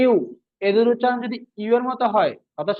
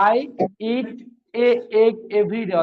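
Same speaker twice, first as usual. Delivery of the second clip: word by word, with pauses between I, eat and the rest; slow example sentence; much slower than usual. Shown as a demonstration. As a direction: dictate it slowly to the class. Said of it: "যে বলা হলো যে". (থেমে থেমে ধীরে উদাহরণ পড়া)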